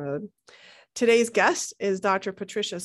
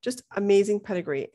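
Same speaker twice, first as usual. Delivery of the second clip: normal talking voice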